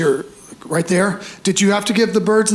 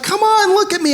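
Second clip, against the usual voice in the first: wife pleading; 12 semitones higher than usual. Retold or imitated. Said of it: "my wife's like".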